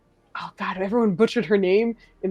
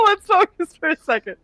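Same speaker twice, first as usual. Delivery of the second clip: laughing; 10 semitones higher, 9 dB louder than usual. Real, not acted. Laughing, calling group back.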